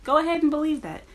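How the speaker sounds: eye-rolling sarcasm at commenters; sarcastic dismissal. Retold or imitated. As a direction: brush them off mockingly. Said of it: "It's like".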